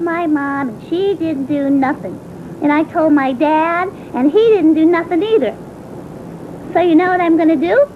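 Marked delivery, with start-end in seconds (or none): none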